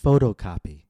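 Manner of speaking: "Photocopy" with PHOTO punched loud; stress on first noun. Shown as a demonstration. Teacher leading listen and repeat, "Photocopy" stressed on PHOTO. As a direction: hit "Photo", let "copy" fall away. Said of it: "paying careful attention to syllable stress".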